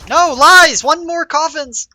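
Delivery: high, loud excited yell; excited shout. Real, not acted. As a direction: yell it out as the round swings. Last enemy spotted in clutch.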